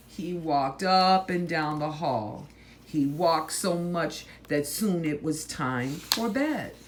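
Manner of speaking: usual speaking voice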